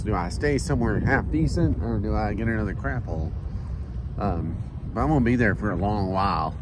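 usual speaking voice

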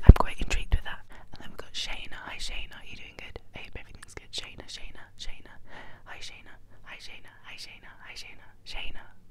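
usual speaking voice

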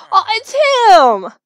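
high, loud, stretched exclamation; loud, drawn-out surprise; startled recognition. Real, not acted. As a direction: cry out at spotting someone. Recognises returning game character.

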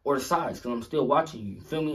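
normal talking voice

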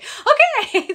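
high, loud laughing okay. Retold or imitated. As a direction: giggle out a startled okay. Startled reply to mom's yell.

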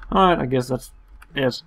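ordinary delivery

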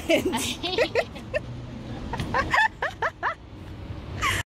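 high breathy laugh. Real, not acted. Laughing at the koala.

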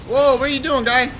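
high, loud startled outburst; alarmed disbelief. Real, not acted. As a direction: call out a teammate's blunder in shock. Reacting to teammate's blunder in game.

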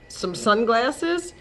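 plain reading voice

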